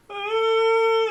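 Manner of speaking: very high, loud ghostly howl; loud high ghost-woman howl; imitated howl. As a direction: wail it like the dog at dawn. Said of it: "I had to really listen".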